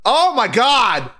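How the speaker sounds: high-pitched, drawn-out real dismay; exasperated panic, pitch leaping up; overwhelmed outburst. Real, not acted. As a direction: cry out that it's all too much. Player overwhelmed by the game's tension.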